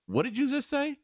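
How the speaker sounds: high-pitched incredulous challenge; disbelieving, high-voiced comeback; retold confrontation. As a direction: replay challenging the neighbor, pitch way up. Acted confrontation with neighbor.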